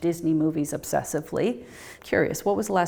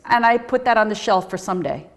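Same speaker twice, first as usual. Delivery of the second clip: ordinary delivery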